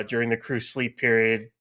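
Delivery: ordinary delivery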